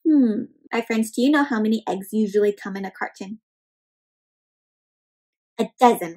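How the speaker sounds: ordinary delivery, nothing marked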